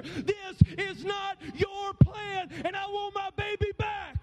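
retold plea of a mother at the bedside; desperate shouted prayer. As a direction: cry out to God for her son. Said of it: "and she was praying".

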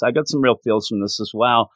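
ordinary delivery

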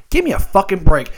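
normal talking voice

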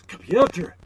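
loud, high-pitched call to a computer; Scotty impression. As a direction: shout into the mouse like it's a microphone. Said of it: "Scotty sits down at the computer".

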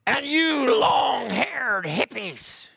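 high, drawn-out shout; mock a preacher's condemning holler; preacher calling out hippies. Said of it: "Brother Doug McHughes was preaching the service".

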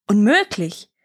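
incredulous outburst, rising sharply; empörter Ausruf. A narrator's reading. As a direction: exclaim it in mild royal outrage. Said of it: "riefen sie". (ungläubig und empört ausgerufen)

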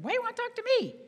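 high-pitched, incredulous comeback; startled protest. Retold or imitated. Speaker retells balking at the agent's call, startled protest. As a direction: squeal it in disbelief at being singled out. Startled reply to caller, retold.

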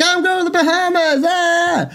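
high, excited mock gloat. Teasing girlfriend he's off to the Bahamas, high gloating whoop. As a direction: rub it in with a sing-song whoop. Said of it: "so I feel mean to just be like".

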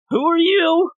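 very high-pitched, rising question; hammed-up senile bewilderment; mock confused old man. Confused old man asks again.

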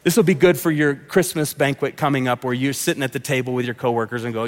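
ordinary talk, nothing marked

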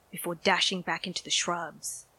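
ordinary delivery

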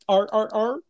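high barking seal sound. Shown as a demonstration. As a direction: bark it out like a seal. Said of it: "I try to sound like a seal".

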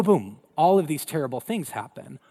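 usual speaking voice

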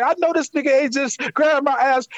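high, incredulous retold complaint; indignant woman. Retelling woman's outraged reaction.

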